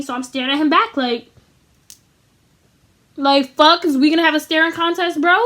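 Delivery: usual speaking voice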